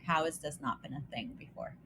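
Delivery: ordinary talk, nothing marked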